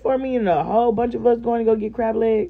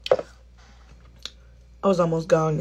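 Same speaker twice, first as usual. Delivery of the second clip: dry cotton mouth; 4 semitones lower, 5 dB quieter, much slower than usual. Real, not acted. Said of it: "that cotton mouth start creeping up".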